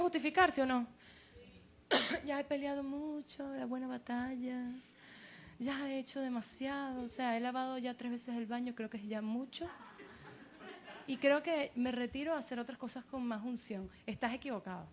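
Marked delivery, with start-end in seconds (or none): 2.2-13.9 soft, drawn-back voice of someone giving up; mock tired believer, much quieter; weary quitter. Imita al creyente cansado que se retira. (creyente cansado que se rinde, en voz baja)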